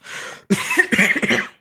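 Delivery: sharp, rasping cough; harsh cough. Acted. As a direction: cough hard, clearing the throat. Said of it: "Uriel is just gonna kinda, like, cough".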